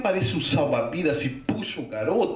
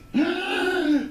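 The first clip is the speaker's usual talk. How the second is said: high, sucked-in gasp for air; mimed drowning gasp. Retold or imitated. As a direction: imitate someone surfacing desperate for breath. Imitates boy surfacing for air. (imitação de quem puxa o ar se afogando)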